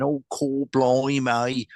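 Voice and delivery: imitated Cockney, not fully put on; half-hearted Cockney. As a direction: have a rough go at Cockney. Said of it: "I can't put on a, a, a, a Cockney accent".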